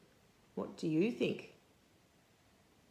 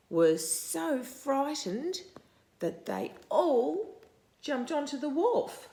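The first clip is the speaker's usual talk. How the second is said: higher, louder frightened storytelling; excited climax; 7 semitones higher, 4 dB louder than usual. A narrator's reading. Reading to a child, excitedly telling the escape. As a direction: make the jump dramatic.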